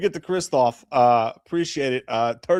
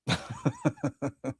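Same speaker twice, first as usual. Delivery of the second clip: ordinary talk, nothing marked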